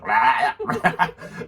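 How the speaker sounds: loud, drawn-out mock retch; imitated retching. Friend spitting back into cups, mock retching. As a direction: gag noisily, twice.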